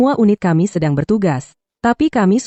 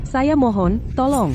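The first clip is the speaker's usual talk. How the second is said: high, frantic begging; desperate pleading; 6 semitones higher, 4 dB quieter than usual. Acted. Mother begging neighbours for a car, high and frantic. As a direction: beg them to save her son.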